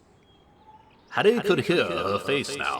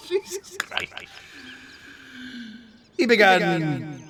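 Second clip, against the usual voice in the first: high, still laughing; laughing disbelief; 11 semitones higher than usual. Real, not acted. Reader stunned by the gory text, laughing. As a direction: gasp it out amazed.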